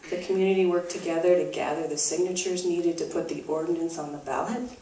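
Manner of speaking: normal talking voice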